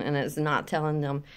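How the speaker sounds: usual speaking voice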